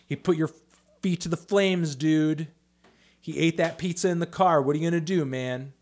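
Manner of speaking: hammed-up trash talk; mock goading. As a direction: egg a rival on, loud and high. Mock goading of a rival reviewer.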